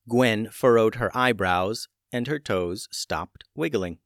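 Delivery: ordinary delivery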